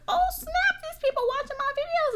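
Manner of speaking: high, quick thrilled voice; excited retold squeal. YouTuber retelling discovering famous viewers, excited high squeal. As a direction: imitate being thrilled, way up high.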